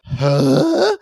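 gasped mock disbelief; imitated astonishment. Host voicing characters seeing the console, mock astonished. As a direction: act out being stunned by the sight. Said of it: "look at the console and go".

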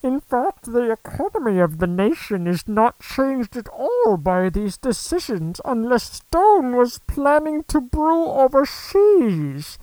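high, slow, whiny complainer. Acted. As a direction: whine every word out slowly. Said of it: "I'll give him a whiny voice".

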